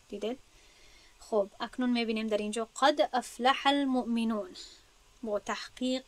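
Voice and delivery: normal talking voice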